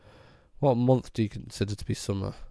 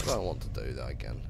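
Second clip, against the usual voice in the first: low deep voice; 10 semitones lower, 6 dB quieter, faster than usual. Pretend. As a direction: drop the voice way down, deep. Repeats line in low voice.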